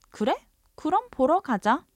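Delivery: fast, bright 그래 with a high lift; quick Korean reply. Shown as a demonstration. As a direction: shoot back 그래 그럼 보러 가자 eagerly. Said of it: "you could say".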